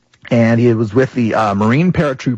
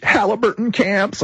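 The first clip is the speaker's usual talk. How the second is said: higher, shaky-voiced old veteran, lightly voiced; a hint of an old man near tears; shaken old man; 9 semitones higher than usual. Old veteran telling the host his fears, lightly voiced. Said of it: "His, his voice is breaking".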